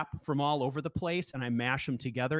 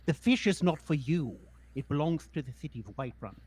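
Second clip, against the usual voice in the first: gently scolding a pet creature; mild mock-stern Marl; Marl's voice; 3 semitones higher than usual. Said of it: "Marl calls him".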